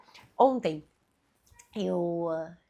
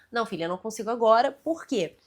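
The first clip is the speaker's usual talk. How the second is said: rushed, fast brush-off; hurried reply; 5 dB louder, faster than usual. Retold or imitated. Busy mother brushes off her daughter, fast and rushed. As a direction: fire it off quickly without attention. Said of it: "Eu falei assim".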